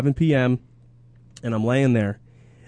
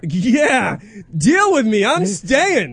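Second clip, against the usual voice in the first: defiant mock shout; 7 semitones higher, 4 dB louder, much slower than usual. Playing the defiant son-in-law.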